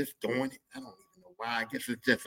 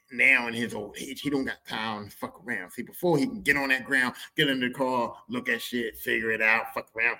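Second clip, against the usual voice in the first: ordinary talk, nothing marked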